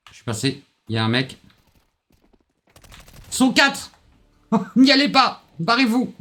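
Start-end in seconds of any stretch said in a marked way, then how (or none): 3.3-6.1 very high panicked laughing yell; panicked shout. Real, not acted. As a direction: shriek the retreat warning. Sees four enemies, panics.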